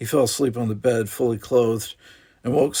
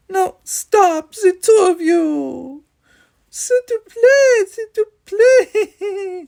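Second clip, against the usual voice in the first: much higher, louder tearful pleading; 10 dB louder, slower than usual. Acted. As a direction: beg them to stop, near tears. Mother begs sons stop.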